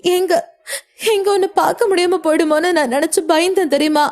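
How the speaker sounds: high-pitched, sobbing through the words; acted sobs, gulping for breath in fear and relief; tearful sobbing. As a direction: cry it out like a frightened child. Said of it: "என்று தேம்பி தேம்பி அழுதாள்".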